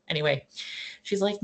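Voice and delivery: ordinary talk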